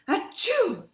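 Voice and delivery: high, loud hammed-up achoo; cartoon sneeze; big fake sneeze. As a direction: throw a huge theatrical sneeze. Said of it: "a pretty dramatic reading".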